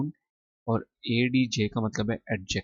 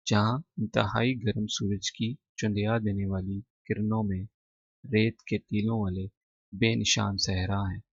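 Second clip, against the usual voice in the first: ordinary delivery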